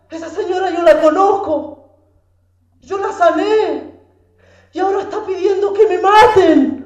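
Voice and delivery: high, loud, wounded voice; anguished lament. Retold or imitated. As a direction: plead in hurt disbelief, rising in force. Cristo dolido en la cruz. (lamento dolido, agudo y fuerte)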